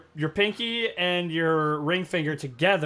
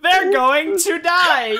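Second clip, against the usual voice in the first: laughing; 12 semitones higher, 5 dB louder, much slower than usual. Real, not acted. Laughing, slow, high voice.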